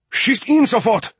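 high-pitched German shout; shouted order. A narrator's reading. Riegel ordering men by microphone to fire, urgent shout. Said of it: "and shouted".

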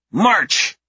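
put-on high loud child command; barking little girl. A narrator's reading. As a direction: bark orders like a tiny guard. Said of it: "the tiny one barks".

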